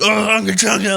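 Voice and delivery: high garbled mock caveman outburst; frenzied caveman. Pitching a caveman on energy drink, high hammed-up frenzy.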